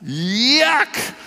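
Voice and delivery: high, drawn-out yuck of disgust. Acted. Pastor reacting to a church scandal, exaggerated disgust. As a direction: recoil in exaggerated revulsion.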